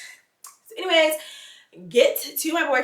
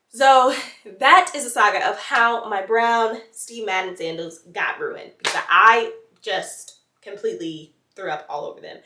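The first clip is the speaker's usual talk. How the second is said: ordinary delivery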